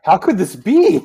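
hammed-up shocked dad, rising high at the end; mock shock. Dad reacting to the news about Peter, mock shock. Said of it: "his dad is so shocked".

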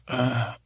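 short acted throat-clear. Awkward beside a crying stranger, clearing the throat. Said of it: "clear my throat".

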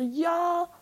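lightly voiced clerk promising a call; imitating clerk. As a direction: mimic the mechanic offhandedly. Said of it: "Und er war so".

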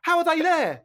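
building to an exasperated, baffled protest; mounting incredulity. Real, not acted. Podcast guest rants to co-hosts about the film's funeral scene, increasingly incredulous.

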